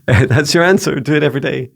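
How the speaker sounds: high amused laugh through words. Real, not acted. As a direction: giggle out the answer. Laughs at spirit guide answer.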